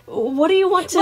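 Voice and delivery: high, loud, eager offer; Sun, eager. A narrator's reading. Sun asking children to play, eager and high. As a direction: pitch it up like an eager playmate.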